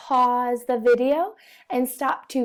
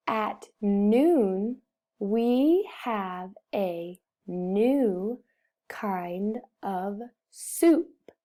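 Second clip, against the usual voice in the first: very slow, word by word; slow model reading; 2 semitones lower, much slower than usual. Shown as a demonstration. Teacher reads the sentence for pupils, slow and deliberate. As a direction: read it out slowly with spaces between words.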